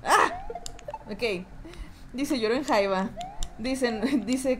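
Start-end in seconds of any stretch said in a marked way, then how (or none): 0.0-0.5 short high yelp; startled shriek. Real, not acted. Streamer startled by the game, sharp high yelp.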